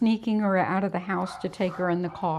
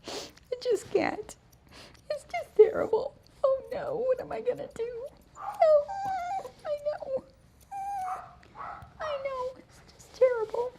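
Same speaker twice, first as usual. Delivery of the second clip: much higher, mock-sobbing dog; whiny dog voice; 5 dB quieter than usual. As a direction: voice the dog as a dramatic crybaby. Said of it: "He's like".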